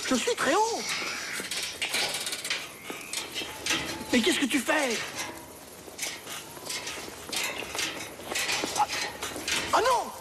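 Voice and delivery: very high frantic cries; panicked yelling. Acted.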